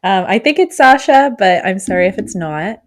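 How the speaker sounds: ordinary talk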